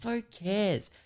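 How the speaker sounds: high, soft bratty brush-off; mock dismissive brat. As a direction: imitate a spoilt girl not caring. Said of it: "and she's going".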